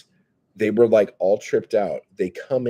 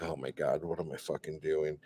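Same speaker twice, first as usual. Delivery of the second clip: inner embarrassed thought; 3 semitones lower, 10 dB quieter than usual. Retold or imitated. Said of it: "and I'm like".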